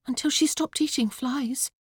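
put-on high, matter-of-fact girl; young daughter. A narrator's reading. Daughter correcting her father's eulogy, high and matter-of-fact. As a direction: point it out in a girlish voice.

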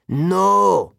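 sudden high, loud cry of denial; shouted no. Acted. Doctor Thorne refusing to believe it, a loud shocked shout. Villain's plan foiled by manticore.

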